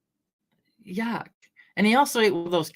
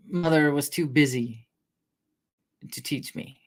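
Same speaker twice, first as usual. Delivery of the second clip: usual speaking voice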